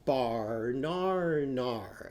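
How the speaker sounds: "Bar, nar, nar" with a long ar in each beat; ar vowel. Shown as a demonstration. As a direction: sing "Bar, nar, nar" like an aardvark's ar. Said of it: "Arnie Aardvark's banana".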